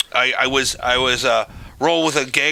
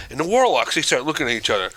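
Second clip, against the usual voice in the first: ordinary talk, nothing marked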